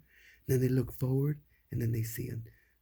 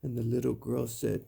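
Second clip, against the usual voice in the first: usual speaking voice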